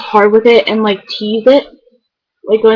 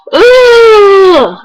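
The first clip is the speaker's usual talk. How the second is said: loud, higher exasperated "ugh" shout; frustrated ugh cry; 9 semitones higher, 6 dB louder than usual. Retold or imitated. Imitating her friend's exasperated loud ugh cry. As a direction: let out a fed-up groaning cry. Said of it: "and she's like".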